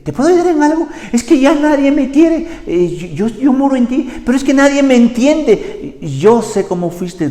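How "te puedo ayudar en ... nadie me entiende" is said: high, loud, plaintive lament; Holy Spirit lamenting that nobody listens; sad Holy Spirit. Retold or imitated. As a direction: sound hurt and ignored, pleading. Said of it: "y te dice".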